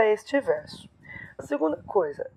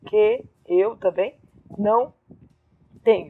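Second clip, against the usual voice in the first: syllable-by-syllable scansion. Shown as a demonstration. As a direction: pause between the pieces of the verse. Teacher scans verse by syllables.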